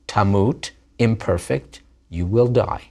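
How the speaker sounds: ordinary talk, nothing marked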